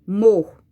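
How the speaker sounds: "Mor" alone, punched loud; stressed syllable. Shown as a demonstration. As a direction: hit "Mor" as the strong syllable. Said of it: "Qual é a sílaba que eu falo com mais forte". ("Mor" sozinho, bem forte)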